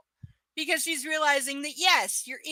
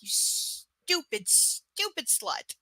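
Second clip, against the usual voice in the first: slow, scornful drawl; slow contempt; drawn-out insult; 3 dB quieter, much slower than usual. Real, not acted. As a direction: stretch every word out in contempt.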